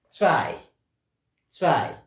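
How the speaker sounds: "Zwei" said loud, with a ts sound, tsvai; teacher models Zwei loudly for the class; model word for repeating. Shown as a demonstration. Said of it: "Repeat that".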